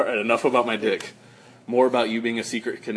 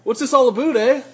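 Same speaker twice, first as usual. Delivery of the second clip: high, loud mock Canadian "eh"; playful Canadian accent parody; 10 semitones higher, 5 dB louder than usual. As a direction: tease him with a high Canadian eh. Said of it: "Was that a Canadian accent?".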